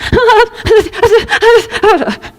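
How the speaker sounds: high, frantic half-laughing wail; panicked laugh-cry. Retold or imitated. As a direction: burst into a hysterical laugh-sob. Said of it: "My reaction would be".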